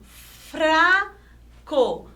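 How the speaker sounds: "Fraco" drawn out in two parts, R rolled; trembling R. Shown as a demonstration. Teacher showing an example that trembles, drawn-out rolled R.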